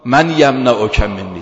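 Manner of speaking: a loud threatening shout, drawn out; enemy's shout. Retold or imitated. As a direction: yell it at the sleeping Prophet. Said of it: "فریاد زد". (فریاد بلند و تهدیدآمیز دشمن)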